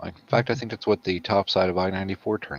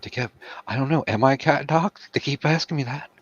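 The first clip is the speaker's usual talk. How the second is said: cat voice; 8 semitones higher than usual. Pretend. Voicing the cat.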